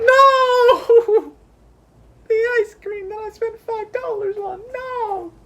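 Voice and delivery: very high, mock-tearful wail fading from a shout; hammed-up wailing. Mourning the five-dollar ice cream, in mock anguish. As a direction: cry out in exaggerated grief, then whimper.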